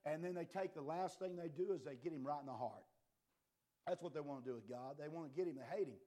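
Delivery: ordinary talk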